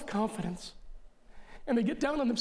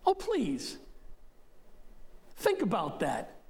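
a pitched-up oh please; scoffing; slower than usual. Real, not acted. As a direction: wave it off with scorn. Dismissing the misreading.

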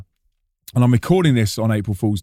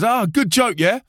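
high voice of a pleased prankster; Flo; 9 semitones higher than usual. Retold or imitated. Prankster to sweating singer off stage, high teasing question. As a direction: imitate a friend proud of his joke.

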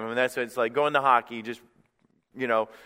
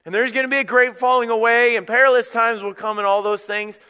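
high, loud prophetic warning; full-force preaching; 9 semitones higher, 7 dB louder than usual. Real, not acted. Preacher warns of perilous times, preaching at full force. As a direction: raise the voice and sound the warning.

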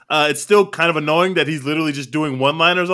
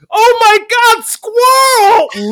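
shrieked high and loud, mock announcer; imitating announcer's yell; 8 dB louder, much slower than usual. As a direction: screech it like an over-excited woman commentator. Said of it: "listening to this woman yell about squirrel".

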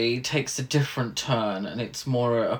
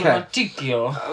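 mock Italian accent. Shown as a demonstration. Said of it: "in an Italian accent?".

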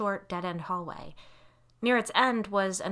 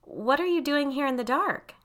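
high, louder demand, trying to sound light; pitched-up, insistent parent's question; 8 semitones higher, 5 dB louder than usual. A narrator's reading. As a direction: demand an explanation with failed lightness. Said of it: "I demanded, trying to keep my voice light".

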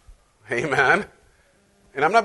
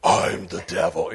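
low, slow mock devil voice; 10 semitones lower, slower than usual. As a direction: drag it out like a cartoon devil. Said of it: "You don't hear like".

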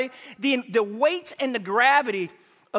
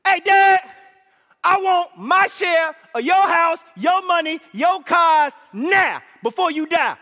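much higher, louder, slow shouted demand; hammed-up shout; 7 semitones higher, 6 dB louder, slower than usual. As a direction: bellow the greedy demand at Dad. Son voiced demanding inheritance.

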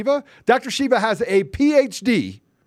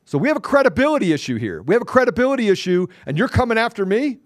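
ordinary talk

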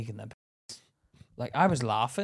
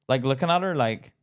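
ordinary talk

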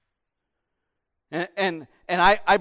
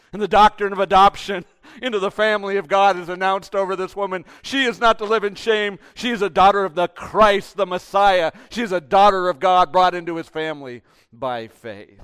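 loud, raised, emphatic proclamation; full-force preaching; 4 semitones higher, 10 dB louder than usual. Real, not acted. Preacher announcing adoption over the woman, loud declaration. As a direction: proclaim it to the whole church at full voice.

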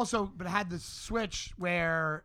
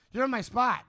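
a touch of a firm dad claiming his seat; raised, firm you're in my spot; dad's warning; 6 semitones higher, 5 dB louder than usual. Retold or imitated. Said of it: "And my dad goes".

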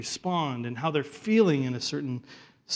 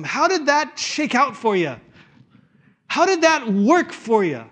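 Alistair Begg; 9 semitones higher, 5 dB louder than usual. Retold or imitated. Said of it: "only as Alistair Begg could".

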